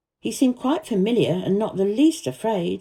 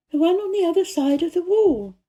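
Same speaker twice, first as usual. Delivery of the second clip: put-on lightly higher girl's voice, earnest; a girl telling the gardener about the secret garden, higher voiced; young Mary voice; 10 semitones higher than usual. A narrator's reading. Mary's voice in the story.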